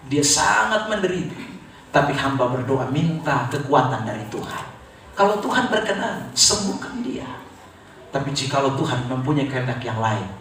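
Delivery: low, measured pleading prayer; subdued, earnest intercession; re-enacted prayer. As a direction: address God gently on a patient's behalf.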